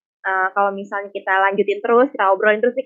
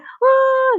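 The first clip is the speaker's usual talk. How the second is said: high, loud mock wail of a small child; imitated crying wail; 6 dB louder than usual. Showing how children cry at that age, imitated wail. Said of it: "mereka kalau lagi nangis".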